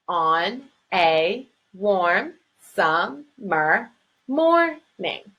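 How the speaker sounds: very slow, On a warm summer morning cut into separate syllables; counting syllables. Shown as a demonstration. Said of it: "Seven syllables".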